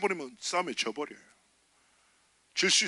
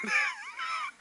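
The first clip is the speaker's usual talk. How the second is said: shouted laugh; 4 dB quieter than usual. Real, not acted. Pastor cracks up at getting beaten, shouted laugh. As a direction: howl with laughter. Laughs at his own joke.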